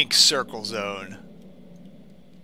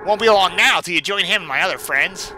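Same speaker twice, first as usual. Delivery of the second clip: menacing villain; 5 semitones higher, 5 dB louder than usual. Acted. Reads Sonic.exe threat.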